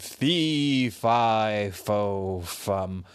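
slow, booming giant chant. Acted. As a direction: stomp it out like a giant. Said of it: "And the kid giant said".

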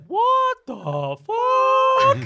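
very slow, high, loud shocked cry; slow-motion shock. Acted.

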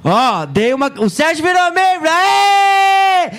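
high, loud victory shout; triumphant shout. Real, not acted. Streamer cheers after his cartwheel wins a member, triumphant shout.

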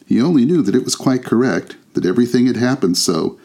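usual speaking voice

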